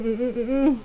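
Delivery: usual speaking voice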